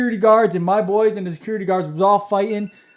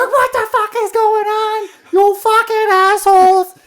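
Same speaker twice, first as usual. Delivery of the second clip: put-on higher, yelling mom in a Massachusetts accent; angry mom with a thick Massachusetts accent; 14 semitones higher, 6 dB louder than usual. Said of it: "With that, like, Massachusetts accent".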